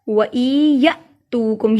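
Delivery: slow वई या तूकुम with a jolt on या; jerked या. Shown as a demonstration. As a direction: cut या short with a catch, hold तू long. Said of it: "या को झटका दिया".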